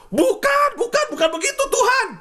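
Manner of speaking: high, pleading no-no-no; mock the stubborn people objecting to God; whiny protest.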